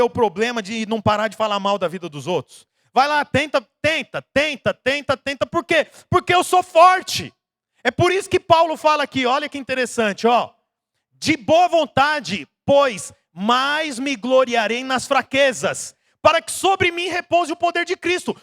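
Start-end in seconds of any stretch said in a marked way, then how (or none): 2.9-7.3 higher and louder, taunting repetition; mocking push. Preacher mocking believers who rely on their own strength, rising loud. Mocking proud self-reliance. 11.1-18.4 slow, higher, emphatic reading; solemn scripture reading. Acted. Preacher reading Paul's words to the church, slow and solemn.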